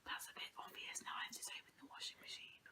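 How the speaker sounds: sneaky whisper, plotting the hiding spot; whispered planning between friends. Real, not acted. Hiding a book, S0 nearby.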